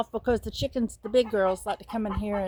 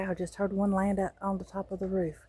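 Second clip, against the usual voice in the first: ordinary talk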